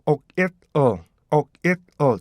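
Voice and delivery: 옥, 잇, 어 as three short separated syllables; oil in three parts. Shown as a demonstration. Teacher reads oil part by part, segmented pronunciation. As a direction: chop oil into "옥, 잇, 어". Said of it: "그래서 이거를 읽으면요".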